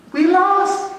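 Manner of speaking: high, loud plea; lost and calling. Pretend. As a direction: sound lost and alarmed. Acting lost tourist. (suara tinggi seperti orang tersesat)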